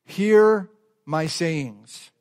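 slow, measured "Hear My Sayings"; slow title. Shown as a demonstration. Pastor reads sermon title to note-takers, slowly. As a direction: lay each word down slowly. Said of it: "the title of today's message is this".

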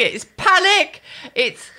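ordinary talk, nothing marked

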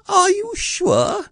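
very high, slow, tiny voice; anxious Piglet. A narrator's reading. Piglet questions Pooh's plan, anxiously. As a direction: squeak it out doubtfully. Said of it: "asked Piglet anxiously".

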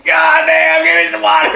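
high shouted plant demand for water; a plant screaming for a drink; parched plant shouting. Pretend. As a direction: yell for water like a dried-up plant. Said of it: "The plants are like".

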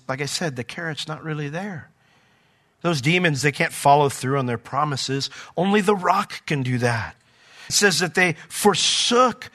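ordinary talk

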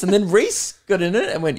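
ordinary talk, nothing marked

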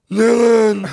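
high, loud slurred call of a name; drunk friend calling out. Retold or imitated. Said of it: "he's like".

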